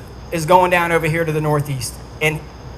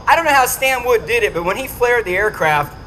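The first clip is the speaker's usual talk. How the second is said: usual speaking voice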